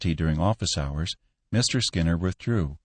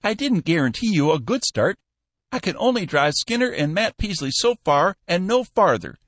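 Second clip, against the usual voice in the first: put-on high piping old man, teasing; old Cappy Ricks; 12 semitones higher, 4 dB louder than usual. Old boss teasing his new clerk, high piping voice. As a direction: squeak it out like a spry old boss.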